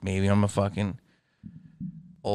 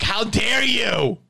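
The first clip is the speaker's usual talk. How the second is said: mock outrage; 8 semitones higher, slower than usual. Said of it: "Now it's like".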